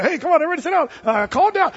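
lightly voiced small train operator, easygoing; little guy. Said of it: "I was expecting this little guy".

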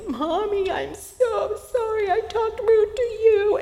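put-on high, slow, tearful little-girl apology; crying child. Toddler to her mother, apologizing in a high, slow, crying voice. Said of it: "started crying and saying".

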